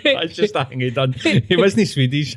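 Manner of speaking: high, giggly mocking repeat; laughing mimicry. Teasing a co-host over his Swedish claim, laughing mimicry. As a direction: echo it mockingly through laughter.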